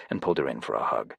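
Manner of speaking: plain reading voice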